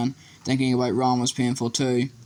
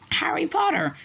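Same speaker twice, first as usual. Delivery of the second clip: much higher squeaky house elf; high-pitched elf voice. A narrator's reading. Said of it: "in a high-pitched voice".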